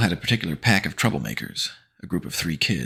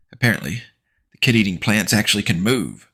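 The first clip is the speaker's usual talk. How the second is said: higher, louder mock surprise; sarcastic quip; 5 semitones higher, 6 dB louder than usual. Narrator mocking the bullies, sarcastic. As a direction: needle them with it.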